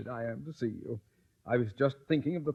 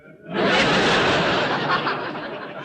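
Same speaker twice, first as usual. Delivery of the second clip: loud high-pitched mocking guffaw; a big breathy cackle at the Stone's expense; high scornful guffaw; 5 dB louder than usual. Principal mocks Mister Stone.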